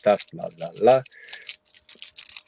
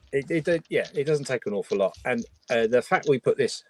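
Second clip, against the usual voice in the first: ordinary talk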